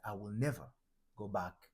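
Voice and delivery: ordinary talk, nothing marked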